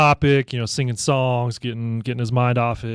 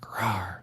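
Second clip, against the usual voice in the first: put-on small, soft kid's roar, much softer; a shy, half-hearted "Rawr"; timid little roar; 4 semitones lower, 11 dB quieter than usual. Son's first try at scaring lions, a soft roar. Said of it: "you gotta do it louder than that".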